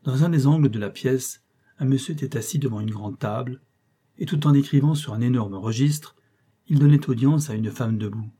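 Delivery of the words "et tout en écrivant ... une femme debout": ordinary delivery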